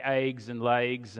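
eggs and legs with Southern long vowels, "aygs and laygs"; Oklahoma-Texas accent. Shown as a demonstration. Pastor showing his childhood speech, eggs and legs in an Oklahoma-Texas accent. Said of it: "drive the accent out of us".

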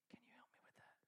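whispered aside to a helper. Real, not acted. Preacher whispering to a helper during the demo. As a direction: breathe it to the helper, voiceless.

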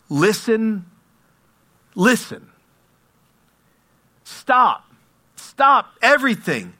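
loud, high calls with long pauses; yelling out. Acted. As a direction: yell out to halt a whole army. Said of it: "a woman yelling out".

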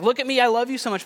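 a showy parent fishing for praise; mock showing off. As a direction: brag it in a sing-song mock. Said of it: "not because I'm like".

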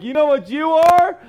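high, loud acted challenge, rising at the end; mock confrontational shout. Tempted to call her names back, mock confrontational shout. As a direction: square up as if about to name-call. Acts out urge to insult her.

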